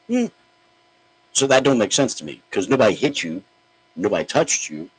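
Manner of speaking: ordinary talk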